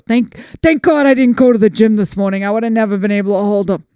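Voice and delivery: lightly voiced cousin, loud relief; relieved cousin. Cousin's relieved outburst acted out.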